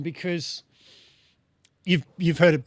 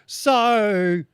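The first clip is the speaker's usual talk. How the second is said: sung "So" in a broad Australian accent; 5 semitones higher, 4 dB louder than usual. Shown as a demonstration. To the host, singing "So" Australian-style, higher. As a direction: belt "So" with a broad Australian vowel. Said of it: "singing in Australian accent".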